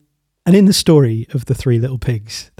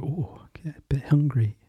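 hungry wolf whisper; 8 dB quieter than usual. Acted. Wolf muttering to himself.

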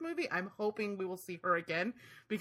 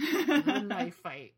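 drawn out; 3 semitones higher, 4 dB louder, much slower than usual. Real, not acted. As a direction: stretch "knife fight" very slowly, laughing. Drawn out, laughing.

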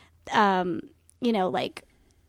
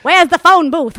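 Katharine Hepburn impression; 7 semitones higher, 14 dB louder than usual. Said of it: "do a good Katharine Hepburn".

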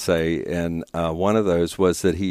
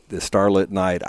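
ordinary delivery

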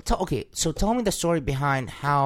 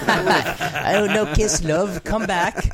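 mock jilted lover; 5 semitones higher, much slower than usual. Mocking jilted girl.

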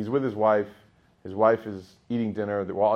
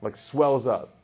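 usual speaking voice

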